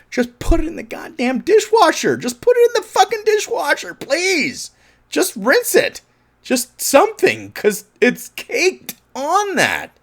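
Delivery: high, loud exasperated yelling; ranting at someone to just rinse the dishes. Retold or imitated. Imitating Jimmy yelling about dishwasher.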